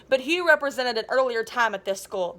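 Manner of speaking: ordinary delivery, nothing marked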